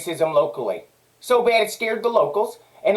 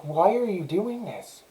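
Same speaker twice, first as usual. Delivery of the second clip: softer, slow and bewildered question; calm plea; 7 dB quieter, slower than usual. Shown as a demonstration. Arrested man asks the officers quietly and slowly, shocked. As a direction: ask it gently, slowly, stunned. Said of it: "That's how I said it".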